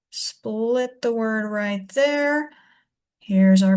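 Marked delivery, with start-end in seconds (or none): 0.1-2.5 slow and deliberate. Shown as a demonstration. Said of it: "teacher marks split".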